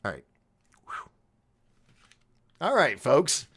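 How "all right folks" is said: high, loud showman voice; character voice. Acted. Host introduces his alter ego Doc Skinner in a big voice. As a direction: announce yourself like a cartoon host. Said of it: "character voice".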